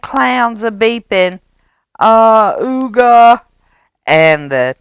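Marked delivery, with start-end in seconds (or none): 2.0-3.4 loud honking ooga; clown horn. A narrator's reading. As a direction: honk like a clown car.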